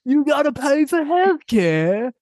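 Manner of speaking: high-pitched, drawn-out British caricature; hammed-up British accent, stretching the words out high; mock British. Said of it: "that's what the British are like".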